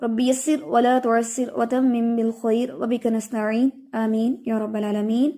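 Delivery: slow, soft opening dua; quiet Arabic supplication; recited prayer. Real, not acted. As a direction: recite it gently before class. Teacher opens class with prayer.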